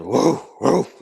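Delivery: dog bark. Retold or imitated. Storyteller mimics a dog heard at night, dog bark. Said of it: "like a dog barking".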